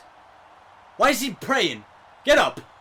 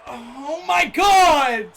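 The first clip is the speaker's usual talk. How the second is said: slow, drawn-out high wail; stretched-out yell of frustration; exasperated cry; 4 semitones higher, 4 dB louder, much slower than usual. Real, not acted. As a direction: wail it long and loud in dismay. Dismayed at another bad pull.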